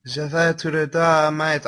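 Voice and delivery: slow, loud attempt at French with "Je vais tout droit" said in an English accent; halting French. Reader stumbles through Jack's French line, slowly and loudly. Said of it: "I'm sorry if I mispronounced that".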